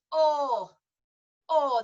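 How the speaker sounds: "or" slow and flat, no glide at the end; Yorkshire O. Shown as a demonstration. Teacher modelling the Yorkshire vowel for singers. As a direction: give "or" as a pure flat northern vowel. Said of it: "no diphthong in a Yorkshire O".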